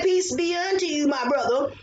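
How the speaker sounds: usual speaking voice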